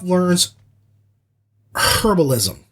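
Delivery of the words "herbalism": "herbalism" with the H sounded hard. Retold or imitated. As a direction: lean on a breathy H at the start of "herbalism". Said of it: "That's a hard H".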